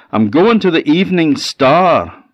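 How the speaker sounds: called back high and loud; calling back. A narrator's reading. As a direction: shout back cheerfully. Death calls back.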